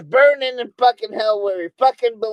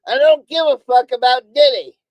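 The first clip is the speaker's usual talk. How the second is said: loud, punched-out, fed-up declaration; real irritated outburst; angry emphasis; 7 dB louder than usual. Real, not acted. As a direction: hammer each word out louder, really fed up.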